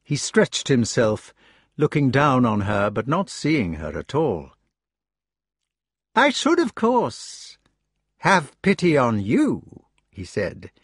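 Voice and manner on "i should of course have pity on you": put-on high, slow, silkily menacing young knight; Mordred taunting, higher and drawn out; sneering Mordred. Mordred taunting Jenny with his scheme, high and slow.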